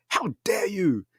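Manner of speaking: high-pitched affronted gasp of a line; the snooty manager, told to get out, in high mock outrage; outraged. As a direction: act personally insulted. Snooty man outraged at threat.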